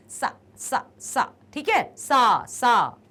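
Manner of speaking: सा said with tongue between the teeth; sa sound demo. Shown as a demonstration. Teacher to students, slowly modelling the सा sound. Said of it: "pronounce करके देखो".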